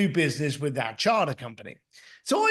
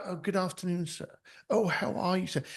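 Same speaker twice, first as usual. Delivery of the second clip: softer, fawning posh British salesman; sucking-up salesman; 6 dB quieter than usual. Retold or imitated. Ferrari store staff greeting a rich customer, fawning and softer. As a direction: lightly imitate a groveling shop clerk. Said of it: "they would suck up".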